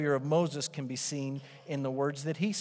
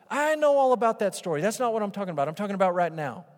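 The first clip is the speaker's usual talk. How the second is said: higher, louder, pushing back; higher, louder exasperated doubter; insistent doubter; 5 semitones higher, 6 dB louder, faster than usual. Retold or imitated. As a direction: insist it's about now. Voicing the doubter, rising insistence.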